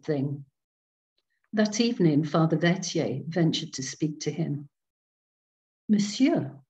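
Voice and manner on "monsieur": timid French priest. A narrator's reading. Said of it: "rather timidly".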